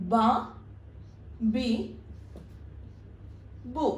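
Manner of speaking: ബ, ബി, ബു said slowly, one by one with long pauses; Arabic syllables ba bi bu. Shown as a demonstration. Teacher drilling syllables for students, carefully. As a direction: sound out ബ ബി ബു as separate syllables. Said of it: "അപ്പോ".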